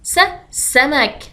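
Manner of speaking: س held, then سمك slowly; slow word demo. Shown as a demonstration. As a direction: stretch س into سمك. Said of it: "مثلا حرف السين مع المد القصير".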